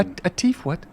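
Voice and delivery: put-on a scared young woman confiding in a stranger; timid young woman. GM voices frightened young woman.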